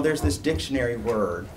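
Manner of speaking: ordinary talk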